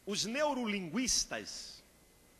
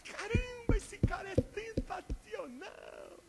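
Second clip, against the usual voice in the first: 1 amazed admiration; slower than usual. Retold or imitated. Voter won over by candidate.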